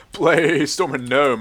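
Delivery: drawn-out, loud callout of a play; excited game callout. Real, not acted. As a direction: stretch it out slow and loud. Calling out a game event.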